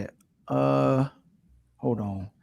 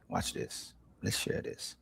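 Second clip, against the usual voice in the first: unvoiced whisper to himself; 21 dB quieter, slower than usual. Real, not acted. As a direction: whisper it under your breath. Muttering while working the screen.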